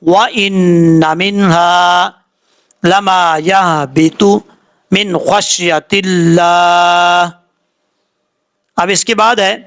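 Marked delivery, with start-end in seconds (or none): 0.0-7.3 chanted tajweed recitation; Quran recitation. Acted. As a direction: recite slowly in a melodic chant. Recites the verse for practice.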